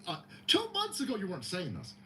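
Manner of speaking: ordinary delivery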